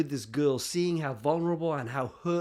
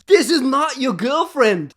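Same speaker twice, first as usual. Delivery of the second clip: loud, slow, higher-pitched retold insistence; emphatic insistence; 7 semitones higher, 10 dB louder than usual. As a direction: drive every word home forcefully. Friend insists for third time.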